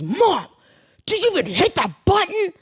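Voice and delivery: high-pitched mock yell at mom; imitated irate son scolding mom; imitated screaming. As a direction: yell up the hill, exasperated. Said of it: "this voice from below screaming".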